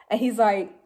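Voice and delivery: plain reading voice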